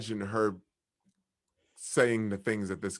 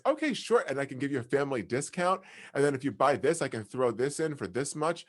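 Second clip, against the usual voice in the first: put-on much higher sugary little-girl sales pitch; cute shopkeeper girl; 4 semitones higher than usual. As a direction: play the sweet kid shopkeeper closing a deal. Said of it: "tries to be all cute".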